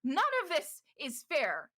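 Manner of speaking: stammer, then climb into a jealous demand; Morrow's heated reply. Acted. Morrow confronting Ystera about her ex, high and jealous. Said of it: "Morrow goes".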